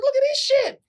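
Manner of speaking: marvelling at himself in the mirror; amazed boast. Retold or imitated. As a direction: show off in delighted disbelief. Admiring his new body.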